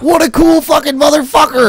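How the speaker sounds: excited exclaim. Retold or imitated. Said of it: "and I was like".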